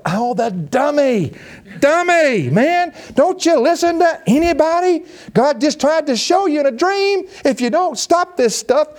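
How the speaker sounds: high mock scolding of the foolish king; high teasing rebuke. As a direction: scold him like a silly child, way up high. Preacher mocking the king's pride.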